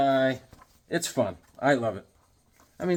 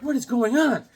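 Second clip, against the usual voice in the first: mock bewildered; 10 semitones higher than usual. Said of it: "He's like".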